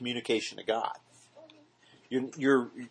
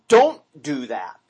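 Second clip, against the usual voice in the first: slow, louder stern command; firm warning; 9 dB louder, slower than usual. Retold or imitated. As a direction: lay down the warning slowly and firmly. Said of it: "He says".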